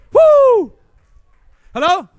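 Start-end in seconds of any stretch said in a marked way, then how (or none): 0.1-0.7 excited whoop. Real, not acted. Preacher hyping crowd.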